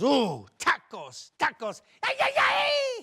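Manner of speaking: building to a very high, loud shriek; mock-despairing Mexican-accented scream; hammed-up Mexican wail. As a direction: cry out in mock horror, climbing to a scream. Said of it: "the Mexican opens up lunchbox".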